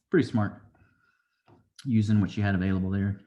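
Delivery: ordinary talk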